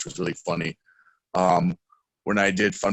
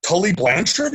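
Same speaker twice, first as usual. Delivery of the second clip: excited guess; 10 semitones higher, 4 dB louder than usual. Retold or imitated. Said together.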